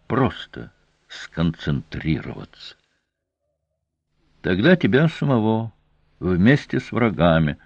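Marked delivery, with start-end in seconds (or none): none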